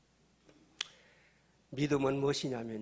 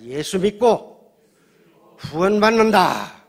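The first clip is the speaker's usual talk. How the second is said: loud, raised chant-like scripture proclamation; full-force declaration; 4 semitones higher, 12 dB louder than usual. Real, not acted. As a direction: proclaim it for the congregation to repeat. Leads congregation in shouted declaration.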